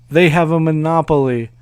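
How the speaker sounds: usual speaking voice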